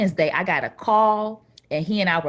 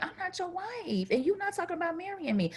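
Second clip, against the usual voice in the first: sassy retort; 11 semitones higher, 7 dB quieter than usual. Retold or imitated. Retorting to boyfriend.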